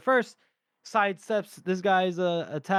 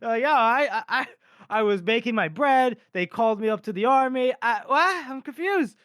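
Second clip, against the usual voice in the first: high, louder flustered stammer; bewildered conscript; 6 semitones higher, 4 dB louder than usual. Acted. Reluctant baker called up to the army, flustered confusion. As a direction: stammer in mock panic, pitch climbing to a baffled squeak.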